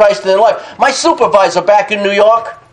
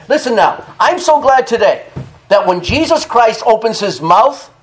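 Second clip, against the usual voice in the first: usual speaking voice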